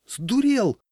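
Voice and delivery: high, outraged outcry; alarmed. A narrator's reading. Crowd protesting the leader's order, alarmed. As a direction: cry out as if he's mad. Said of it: "шарахнулась толпа".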